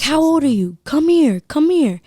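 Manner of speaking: high, loud imitated "come here"; beckoning like a creep, imitated; mock catcaller yell. Imitating catcaller calling her over.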